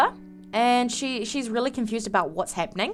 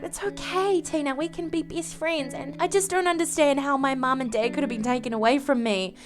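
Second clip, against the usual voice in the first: higher, softer daughter voice; small, gentle voice consoling a sister figure; lightly acted grieving girl; young daughter; 4 semitones higher, 4 dB quieter than usual. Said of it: "and Anya's like".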